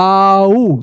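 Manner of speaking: long, slow glide on "आओ"; drawn-out blend. Shown as a demonstration. Teacher demonstrating how ओ comes out, drawn out. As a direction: hold both vowels of "आओ" as they merge. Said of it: "बोल के देखो".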